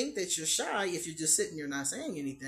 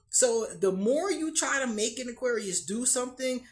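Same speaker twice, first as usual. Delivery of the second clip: usual speaking voice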